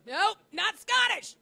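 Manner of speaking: a high, loud, slow teasing shout; mock shout. Real, not acted. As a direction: shout him down in a tease. Teasing protest at fake accent.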